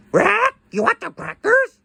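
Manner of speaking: high squawky parrot voice; parrot squawk. Pretend.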